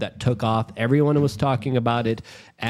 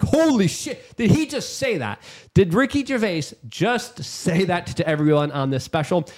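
flabbergasted disbelief; 6 semitones higher than usual. Retold or imitated. Retelling his reaction to the comedy special, stunned and amazed. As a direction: blurt it out like you can't believe your ears. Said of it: "I was left flabbergasted".

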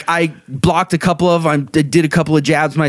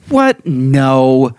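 jolted disbelief at the offer; surprised refusal; 4 dB louder than usual. Retold or imitated. Offered a free punch, startled louder refusal.

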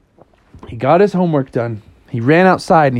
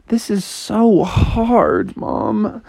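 put-on drawn-out, higher-pitched kid whine; slow acted whine; whining complaint; 7 semitones higher, much slower than usual.

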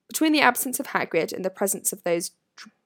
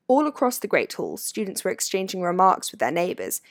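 plain reading voice

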